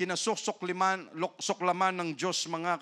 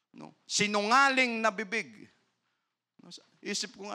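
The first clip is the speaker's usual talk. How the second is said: ordinary talk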